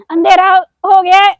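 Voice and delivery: high, loud whiny wail; mock whining. To her viewers, a loud mock whine that it's dark. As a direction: whine it like a tired child. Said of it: "repeats the line plainly".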